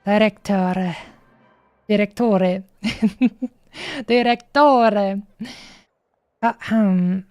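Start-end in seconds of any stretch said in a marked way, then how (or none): 1.9-2.6 mock Italian accent. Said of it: "I really can't do this kind of, uh, accent". 4.1-5.2 mock Italian accent. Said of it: "I really can't do this kind of, uh, accent".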